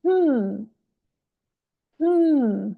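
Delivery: teacher's demonstration hum; one-syllable hum. Shown as a demonstration. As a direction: hum one long falling beat, pause, repeat. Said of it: "can hum one syllable".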